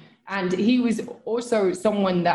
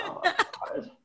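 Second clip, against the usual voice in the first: whispered oh my God; 11 semitones higher, 7 dB quieter, slower than usual. Retold or imitated. Retold awe walking past.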